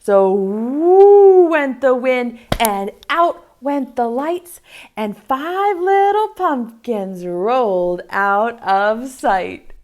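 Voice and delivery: slow, sing-song rhyme reading; poem recital. Acted. Teacher reciting a poem to young kids, slow and sing-song. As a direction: recite the rhyme slowly for small children.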